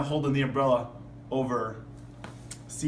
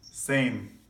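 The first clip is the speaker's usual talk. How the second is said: plain reading voice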